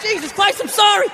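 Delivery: high, exasperated apologizing; flustered apology. Retold or imitated. Apologizing again after lunge.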